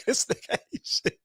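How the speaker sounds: whispered aside. Retold or imitated. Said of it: "I said".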